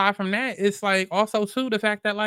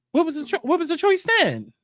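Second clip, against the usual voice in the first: much higher, incredulous demand; high incredulous what was the choice; indignant challenge; 10 semitones higher than usual. Real, not acted. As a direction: push the question up in disbelief.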